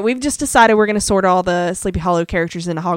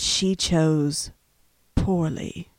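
a hint of the solemn old knight, lower; Last Crusade knight quote; 7 semitones lower, much slower than usual. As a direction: intone it gravely like the ancient guardian. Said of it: "Indiana Jones and the Last Crusade".

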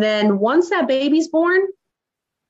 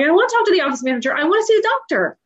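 rushed, rising higher and higher, near tears; upset outburst; 9 semitones higher, much faster than usual. Retold or imitated. Mom fighting tears tells the clinic she wants the doctor, rushed and rising. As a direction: push back hard, voice cracking upward.

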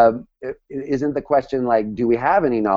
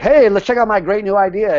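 eager, pitched-up pitch; excited; 8 semitones higher, 4 dB louder than usual. Retold or imitated. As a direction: sell a proud new idea. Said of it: "I was like, you know, all like".

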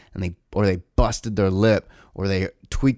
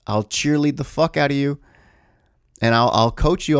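normal talking voice

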